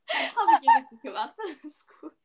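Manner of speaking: laughing through the words; giggling while talking; cracking up mid-line. Real, not acted. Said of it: "[name] kriegt dann gerade auch Lachflash".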